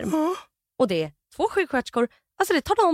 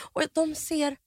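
ordinary talk, nothing marked

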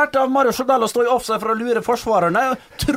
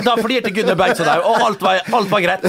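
ordinary delivery

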